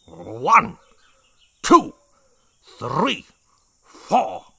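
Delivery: slow, loud count with pauses. A narrator's reading. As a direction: count it out like an order. Brig counting loudly.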